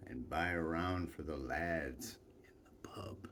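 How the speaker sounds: whispered aside; whispered under the orders. Retold or imitated. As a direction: hush it like a secret. Aside within chieftain's orders.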